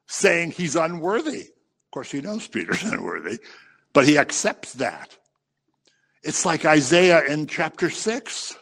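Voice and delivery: ordinary talk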